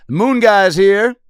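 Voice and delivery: high, loud, slow announcement; grand entrance. Pretend. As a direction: boast like a hero walking into a bar. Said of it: "I'd walk in every bar and be like".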